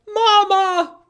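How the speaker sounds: put-on high, slow, loud baby call on mama; gosling voice. Storyteller voicing goslings calling Bruce mama. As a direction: draw out mama like a little gosling.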